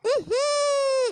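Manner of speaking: long, slow nasal groan of disgust. Acted. Mother-in-law groans at the bride's smell, disgusted. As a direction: groan as the stink hits.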